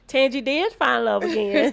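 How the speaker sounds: echoing the question with a loud laugh; bursting into laughter as she answers. Real, not acted. Guest echoes, laughing loudly.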